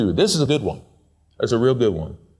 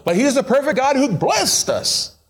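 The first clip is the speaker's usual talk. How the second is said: ordinary talk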